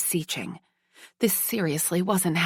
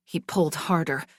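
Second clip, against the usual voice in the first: ordinary delivery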